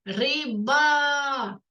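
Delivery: "риба" with a long final а; drawn-out last sound. Shown as a demonstration. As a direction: stretch the а at the end of "риба". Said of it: "звук а".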